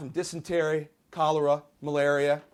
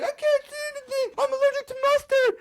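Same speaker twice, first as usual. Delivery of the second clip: very high, fast frantic cry; panicked whine; 6 dB louder, faster than usual. Acted. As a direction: squeal in comic panic. Citizens gassed by mustard gas.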